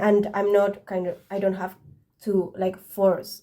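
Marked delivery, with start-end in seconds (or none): none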